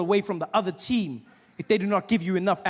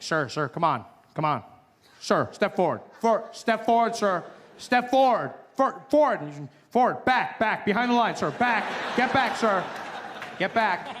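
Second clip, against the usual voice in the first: pushy border officer; 2 semitones higher, 3 dB louder than usual. Retold or imitated. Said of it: "the guys are like".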